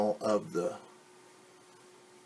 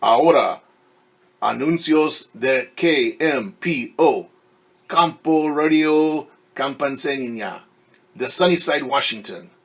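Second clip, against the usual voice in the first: radio announcer; 3 semitones higher, 9 dB louder than usual. Acted. Radio announcement in two languages.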